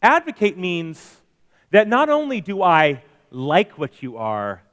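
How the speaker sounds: usual speaking voice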